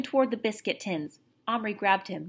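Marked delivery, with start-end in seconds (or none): none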